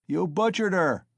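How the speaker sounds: loud, high outraged yell; accusing shout. A narrator's reading. A bystander condemning Mikolka, shouting. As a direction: hurl the accusation. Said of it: "someone shouted in the crowd".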